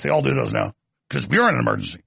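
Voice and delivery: ordinary talk, nothing marked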